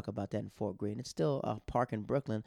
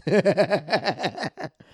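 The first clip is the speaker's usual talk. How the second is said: long, high real laugh; loud burst of laughter; 5 semitones higher, 9 dB louder than usual. Real, not acted. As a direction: crack up loudly at your own story. Laughs at the retold threat.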